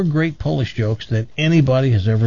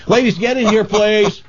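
high calling out to the next room; playful call to the ladies; 10 semitones higher than usual. Real, not acted. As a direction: summon them in, pitched way up.

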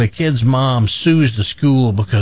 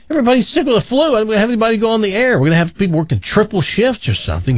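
frantic boss; 8 semitones higher, much faster than usual. Retold or imitated. Stressed boss retold.

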